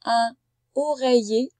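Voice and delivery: "Un oreiller" slow and loud, drawn out; slow dictation. Shown as a demonstration. Teacher dictating "Un oreiller" to a pupil, slow and loud. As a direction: spell it out in sound, slowly. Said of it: "dictating a word to pupil".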